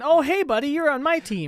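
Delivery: high, loud chummy demon voice; friendly demon. Pretend. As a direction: greet him like an old pal. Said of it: "demons confronting an exorcist and going".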